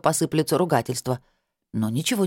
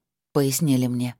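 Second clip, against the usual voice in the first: ordinary delivery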